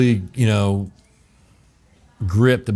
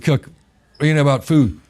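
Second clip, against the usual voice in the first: a higher, louder boss voice challenging; chef's gruff question; 7 semitones higher, 5 dB louder than usual. Retold or imitated. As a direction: play the tough chef sizing him up. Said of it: "he said".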